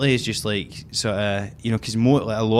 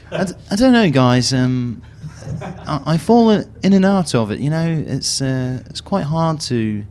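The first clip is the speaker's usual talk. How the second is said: a light put-on English accent, pitched up; 2 semitones higher, 3 dB louder than usual. Shown as a demonstration. Friends ask him for his English accent, and he does it lightly. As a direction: slip into a posh English accent on request. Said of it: "Can we have a blast of that?".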